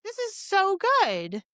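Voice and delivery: high, slow and gushing; drawn-out delighted gush. Retold or imitated. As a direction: swoon over how good it is. Gushing over book on starting it.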